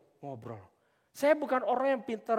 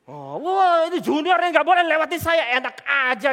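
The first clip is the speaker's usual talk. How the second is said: loud, high indignant outburst; mock outrage at an upstart junior; 7 semitones higher, 11 dB louder than usual. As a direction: play the offended senior. Acted senior resenting a better junior.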